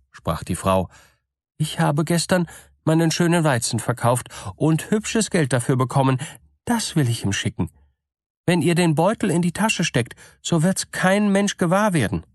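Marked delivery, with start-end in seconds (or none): 1.6-12.2 lightly voiced eager simple woman, higher; eager to hear of her dead husband; gullible woman's voice. A narrator's reading. Woman offers the money. (gutgläubige Frau, eifrig und höher)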